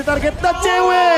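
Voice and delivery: excited play-by-play. Real, not acted. Caster hypes a kill.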